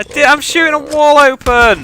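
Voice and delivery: loud, high, thrilled yell; excited shout. Real, not acted. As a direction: shout it out mid-fight. Mid-match excited callout.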